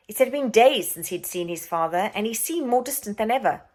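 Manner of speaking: plain reading voice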